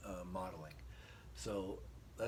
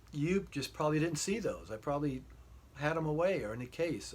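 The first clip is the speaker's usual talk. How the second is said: flustered denial; 6 semitones higher, 6 dB louder than usual. Retold or imitated. Denying to wife.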